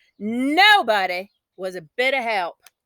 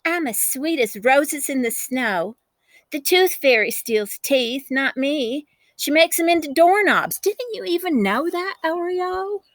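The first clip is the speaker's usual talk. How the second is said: teasing sister; 6 semitones higher than usual. A narrator's reading. Sister teasing from the tree limb. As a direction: taunt your little sister sweetly.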